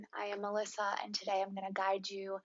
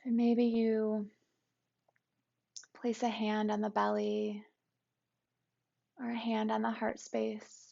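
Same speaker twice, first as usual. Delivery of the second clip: calm, even meditation guide with long pauses; guided meditation. Acted. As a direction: guide listeners gently into the body, unhurried and settled.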